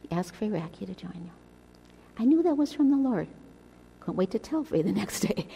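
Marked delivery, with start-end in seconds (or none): 0.1-1.3 soft, hushed and much quieter; still small voice. Retold or imitated. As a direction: hush it down to a gentle inner voice. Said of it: "God's still small voice".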